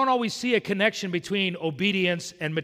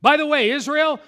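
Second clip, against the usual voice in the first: higher and louder call to Israel; loud preaching call; 9 semitones higher, 8 dB louder than usual. Acted. Preacher voicing God addressing Israel.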